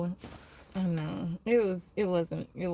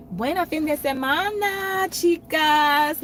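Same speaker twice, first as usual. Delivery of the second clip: higher, hammed-up Spanish accent; mock Spanish accent; 8 semitones higher, 5 dB louder than usual. Shown as a demonstration. Showing how she'd say it to coworkers, in a mock Spanish accent. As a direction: sing it out like a native Spanish speaker. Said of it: "make it seem like I'm Spanish".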